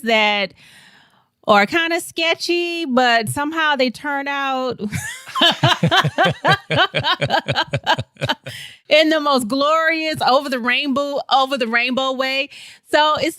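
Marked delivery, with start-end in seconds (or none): 4.9-8.7 long, high burst of laughter; real laugh. Real, not acted. Telling host about lucky sketchy situations, long high laugh. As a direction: crack up at your own story. Laughing at her own story.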